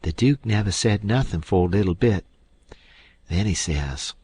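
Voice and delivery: ordinary delivery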